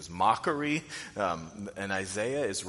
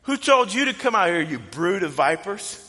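much higher and louder, a brash shouted welcome; bold rebuke; 8 semitones higher, 9 dB louder than usual. Retold or imitated. John the Baptist to the Pharisees, loud brash rebuke. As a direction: bark it at the crowd like a fiery prophet. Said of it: "the way that he's welcoming them is like".

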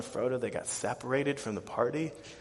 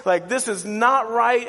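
loud, high mock-panicked protest; acted upset child, voice pitched up; freaking out; 10 semitones higher, 9 dB louder than usual. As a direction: plead with Dad, hammed-up and loud. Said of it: "freaking out".

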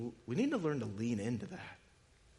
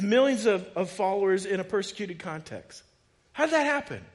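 impassioned question; 9 semitones higher, 9 dB louder than usual. Acted. Rhetorical climax.

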